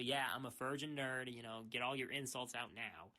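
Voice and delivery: usual speaking voice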